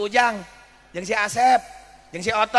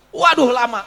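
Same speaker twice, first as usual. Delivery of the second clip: exasperated cry; 3 semitones higher, 9 dB louder than usual. Acted. Sighs at long wait.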